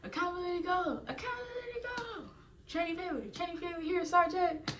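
high mock drill call and reply; drill instructor and recruit. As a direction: act out the roll call. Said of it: "So he's gonna be like".

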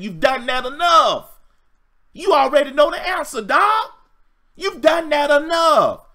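loud, high-pitched exhortation; fired-up insistence. Acted. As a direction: preach it at full voice, pitch way up. Fired-up refusal to lower standards.

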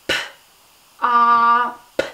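"P, ah, p" each sound held apart; stretched sounds. Shown as a demonstration. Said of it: "stretch the word pop".

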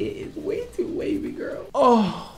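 high, breathy mock wail over the hair; mock cry. To the viewers about too-wavy hair, a mock wail. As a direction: whine like the waves broke your heart.

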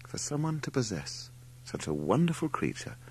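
plain reading voice

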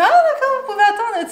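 high, snooty mock; mock her condescension; mocking the blonde. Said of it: "elle fait". (imitation aiguë et hautaine)

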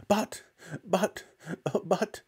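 high, breathless stammer. Acted. Mother stunned by the giant hen, high stammer. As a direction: stumble over the words in shock. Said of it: "stammered George's mother".

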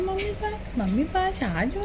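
ordinary talk, nothing marked

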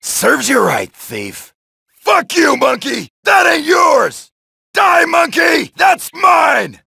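ordinary talk, nothing marked

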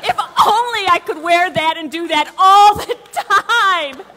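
loud, high, thrilled exclamation; real gleeful excitement; giddy delight. Real, not acted. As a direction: burst out exhilarated after showing off. Delighted after booming the role.

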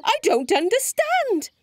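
high-pitched woeful little dinosaur; puzzled character whine; baffled Dewi. A narrator's reading. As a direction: wail it high, at a loss.

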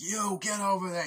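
hammed-up agent shout; mock order. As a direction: gun to his head, order him. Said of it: "He's like".

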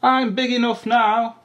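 high, loud little voice; baby elephant. A narrator's reading. Baby elephant answering mum where she'll go, high and eager. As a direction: announce it like an eager youngster. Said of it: "said the baby elephant".

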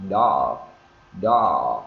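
"đỏ" stretched long and sliding down, as English dog; drawn-out vowel. Shown as a demonstration. Teacher stressing vowel length over ending, "đỏ" drawn out. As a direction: draw the vowel of "đỏ" right out. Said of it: "Thấy cái độ kéo dài chưa".

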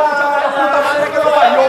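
2 loud furious swearing; 3 let the curse explode, loud; 1 real angry curse. Real, not acted. 4 host fed up with guest, loud furious curse. Host losing his temper on air.

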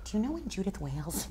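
asking quietly, almost under the breath; secretive hushed question. Retold or imitated. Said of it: "I asked Bob Graff".

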